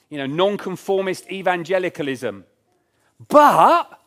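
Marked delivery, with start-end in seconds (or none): 3.3-4.0 sudden loud exclamation. Real, not acted. Preacher exclaims.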